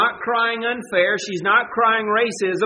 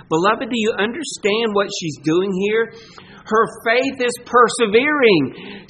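usual speaking voice

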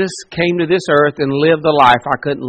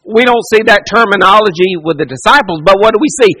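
ordinary delivery

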